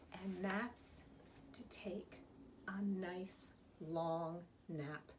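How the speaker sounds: very soft, slow, drowsy wind-down; slow it right down, softly toward sleep; sleepy hush. A narrator's reading. Big Mouse proposing a nap, hushed and slow.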